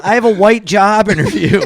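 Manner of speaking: mocking self-quote. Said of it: "mocking his own nervous line".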